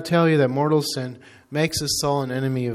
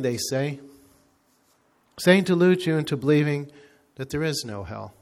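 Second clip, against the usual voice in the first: normal talking voice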